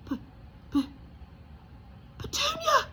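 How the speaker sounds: stuttering, then a high shout of her name; stammered gasp. Acted. Uncle Vernon calling his wife, gasping in shock. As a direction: panic cracking into a shout. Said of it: "he gasped".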